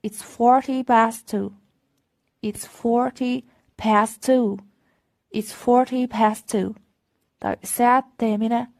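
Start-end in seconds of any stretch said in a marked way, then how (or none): 0.1-6.7 very slow, clear repeated demo; deliberate classroom pacing; slow model reading. Shown as a demonstration. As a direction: drill it slowly for the class. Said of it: "slow repeated models".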